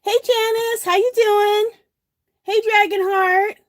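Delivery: bright high-pitched hello; cheery greeting. Real, not acted. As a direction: greet a friend warmly and brightly. Greeting viewers joining the stream.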